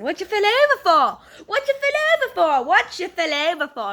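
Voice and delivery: loud, high, hammed-up British accent; mock British accent. Shown as a demonstration. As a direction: shout the catchphrase in exaggerated British. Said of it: "I love doing my British accent".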